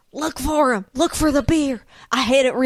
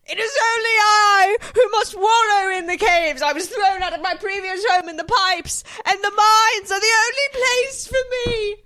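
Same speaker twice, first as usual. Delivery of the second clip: dramatic sobbing hermit; 8 semitones higher than usual. Acted. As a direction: overact the heartbroken cave dweller. Character monologue in the mines.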